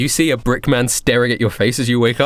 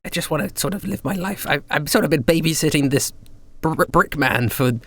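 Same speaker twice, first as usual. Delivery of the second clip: weary Douglas voice; 3 semitones higher than usual. Acted. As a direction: sigh it out like a worn-out babysitter. Douglas tells his weary wish.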